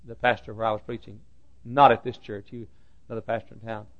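ordinary talk, nothing marked

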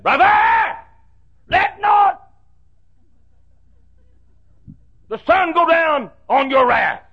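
high, loud shouted scripture; preacher's shout. Retold or imitated. Preacher quoting a colleague to the congregation, shouting. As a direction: bellow the verse up at the rafters. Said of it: "I heard Brother Roloff say".

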